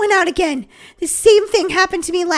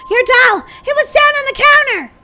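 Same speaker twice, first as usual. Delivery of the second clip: higher, loud alarmed telling; louder frantic explanation; panicked; 8 semitones higher, 7 dB louder than usual. Acted. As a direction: insist urgently. Panicked report to sister.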